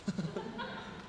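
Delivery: short airy laugh at being picked; a quick breathless chuckle; breathy laugh. Real, not acted. Sister laughs softly when asked her name. Audience member laughs at question.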